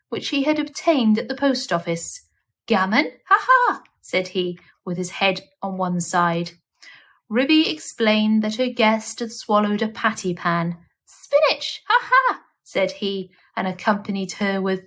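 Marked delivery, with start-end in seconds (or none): 2.7-3.8 high squawking ha ha; magpie doctor. Acted. Magpie doctor cackling at Ruby, high squawk. 11.1-12.4 high squawking ha ha; magpie doctor. Acted. Magpie doctor cackling at Ruby, high squawk.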